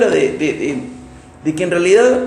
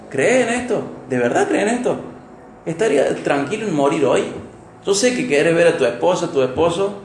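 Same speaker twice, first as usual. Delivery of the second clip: ordinary delivery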